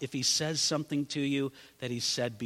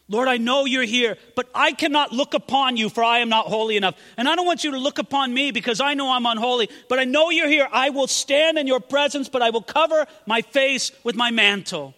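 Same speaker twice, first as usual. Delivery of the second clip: higher, earnest plea, faster in the middle; humble Elijah; 5 semitones higher, 4 dB louder than usual. Acted. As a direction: confess unworthiness with urgency. Elijah humbly addresses God.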